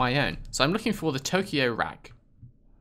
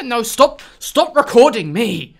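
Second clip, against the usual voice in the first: high, loud, slow no, stop; loud protest; 9 semitones higher, 10 dB louder than usual. Real, not acted. Player shouting at the game to stop recording.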